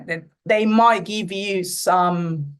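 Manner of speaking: normal talking voice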